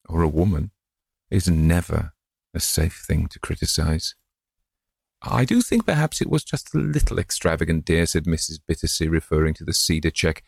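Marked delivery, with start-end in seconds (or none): 5.2-8.1 a fond wife's mild reproach, voiced high; gentle old wife. Old wife to husband about the cheque, gentle reproach.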